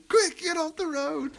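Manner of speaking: high, slow panicked warning; frantic hobbit cry. Shown as a demonstration. Host performs the game-night quote in a frantic high voice. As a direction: draw it out in mock alarm. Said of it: "I do".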